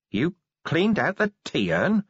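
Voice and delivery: slow, hollow disbelief; a stunned, empty echo of her words. A narrator's reading. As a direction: say it like something precious just died. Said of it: "said Vimes in a hollow voice".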